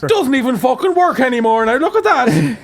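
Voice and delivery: high mock-Irish complaint; exaggerated Irish accent; Christopher Walken gone Irish. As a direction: rant indignantly in stage Irish. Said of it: "Christopher Walken in Pulp Fiction, but Irish".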